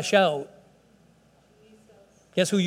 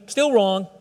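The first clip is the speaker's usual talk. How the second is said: normal talking voice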